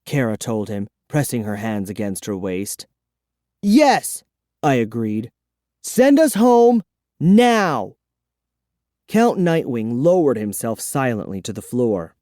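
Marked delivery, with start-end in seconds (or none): 3.6-4.2 put-on eager boy. A narrator's reading. Boy agrees. 5.8-7.9 higher, louder, insistent; demanding boy. A narrator's reading.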